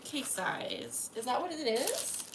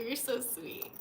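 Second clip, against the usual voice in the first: ordinary talk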